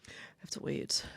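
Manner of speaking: breathy mock of the husband's accent; whispered mock accent. Wife echoes husband's accented line, whispered. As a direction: whisper it, unvoiced, imitating his accent. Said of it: "In his little accent".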